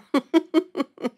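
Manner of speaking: a short, bright laugh at herself; light laugh. Real, not acted. Vlogger laughing at her face on camera, amused laugh.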